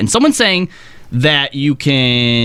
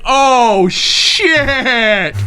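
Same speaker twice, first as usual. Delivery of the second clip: startled; 8 semitones higher than usual. Retold or imitated. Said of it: "we're all like".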